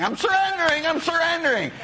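high-pitched, insistent mock plea; hammed-up giving in under pressure; mock desperate protest. Acted protest to insistent prayer group.

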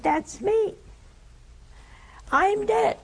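high, amazed cry of recognition; startled realization. Retold or imitated. Dead man seeing his own body, amazed and high. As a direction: gasp it out as he sees himself.

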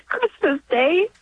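ordinary talk

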